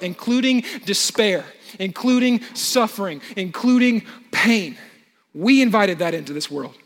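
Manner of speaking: rising sermon intensity. Acted. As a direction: push the sermon to its climax. Preaching builds in force.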